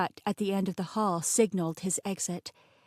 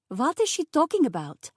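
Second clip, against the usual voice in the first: put-on high, louder French-accented chef; thick French accent; 7 semitones higher, 5 dB louder than usual. A narrator's reading. Chef turning to the driver for help, thick French accent. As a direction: appeal in a baffled French accent. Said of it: "in a thick French accent".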